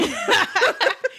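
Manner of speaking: high burst of giggles; high laugh. Real, not acted. Laughing at own joke.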